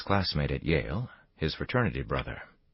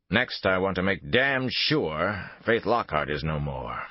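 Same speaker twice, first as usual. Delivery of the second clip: ordinary delivery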